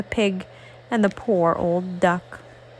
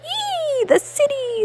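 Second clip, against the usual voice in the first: very high squeal on Ee, the city; pig squealing. Acted. The old pig squeals about going to the city, very high. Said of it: "squeals the old pig".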